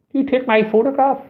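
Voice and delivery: put-on high indignant young woman's voice; mock outraged girl confronting him; girl's angry challenge. Girl confronting photographer in street.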